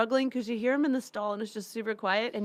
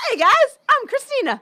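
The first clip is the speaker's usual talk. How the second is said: high, loud mock-perky hello; over-friendly greeting; 13 semitones higher, 11 dB louder than usual. Teasing co-host walking cruise halls, exaggerated perky greeting. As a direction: call out brightly, hammed-up, down a hallway.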